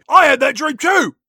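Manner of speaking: high loud cockney yell; mock cockney shout. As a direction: shout it like a rowdy cockney girl. Acting Spice Girls banter.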